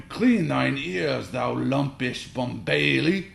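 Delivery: high, loud, slow theatrical insult; Shakespearean cursing dad. A narrator's reading. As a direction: declaim the curse like a stage actor.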